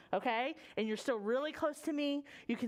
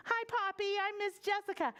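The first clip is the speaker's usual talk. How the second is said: storytime host voice; 7 semitones higher than usual. Acted. Greets a child by name.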